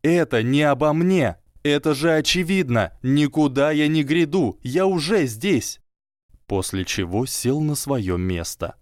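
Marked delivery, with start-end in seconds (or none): 0.0-5.8 high, loud shout over the crowd. A narrator's reading. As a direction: shout over the whole hall. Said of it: "очень громко, перекрикивая".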